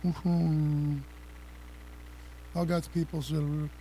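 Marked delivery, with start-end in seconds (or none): 0.0-1.0 exaggerated slow, low drawn-out delivery; deliberately slowed down. Shown as a demonstration. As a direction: stretch each word out, slow and low. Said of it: "Let me just slow down".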